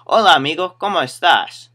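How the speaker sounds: put-on high, loud Spanish greeting with a light country accent; first go at a country-accented Spanish greeting. Customer greeting store worker in Spanish, hint of country accent. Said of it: "Country accent, too, so it's more like".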